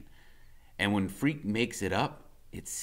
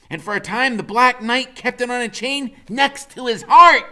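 high, loud, grand legend-telling; Freak's storytelling voice; 13 semitones higher, 12 dB louder than usual. A narrator's reading. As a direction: spin a tall tale with relish.

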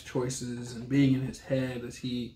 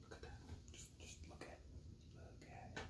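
whisper; 30 dB quieter than usual. Real, not acted. Showing off the book cover.

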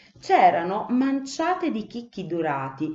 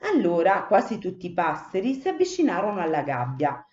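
ordinary delivery, nothing marked

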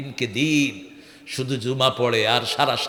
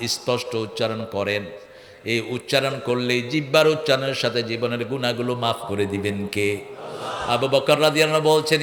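normal talking voice

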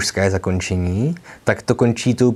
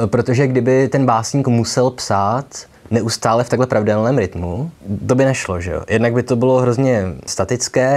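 normal talking voice

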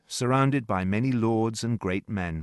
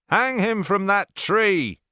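slow, loud, higher command; officer's order; 10 semitones higher, 6 dB louder, slower than usual. A narrator's reading. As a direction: order the hanging with no room for argument. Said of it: "ordered an officer".

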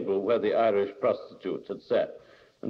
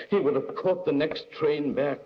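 ordinary delivery

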